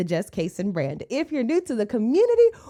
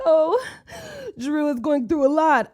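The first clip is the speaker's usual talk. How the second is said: 1 mock sympathy; 5 semitones higher, slower than usual. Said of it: "some of the comments was like".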